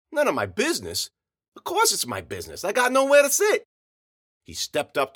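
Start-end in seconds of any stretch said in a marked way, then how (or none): 0.1-3.7 rising to a loud, high protest; indignant. A narrator's reading. Narrator confronts Johnson over the broken chairs, indignation building to a shout. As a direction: build from disbelief to an outraged shout.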